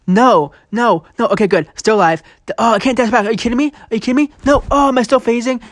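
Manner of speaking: frantic as the fight slips away; real panic. Real, not acted.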